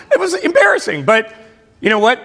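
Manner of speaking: ordinary talk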